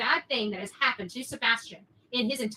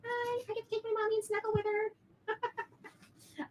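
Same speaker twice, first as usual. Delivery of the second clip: higher, slow, cutesy little-one voice; voicing the little one; 9 semitones higher, 4 dB quieter than usual. Mother saying goodbye to viewers for the little one, cutesy voice. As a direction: speak for the child, sweet and slow.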